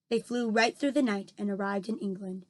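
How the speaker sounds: ordinary delivery, nothing marked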